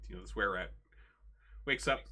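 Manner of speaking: ordinary talk, nothing marked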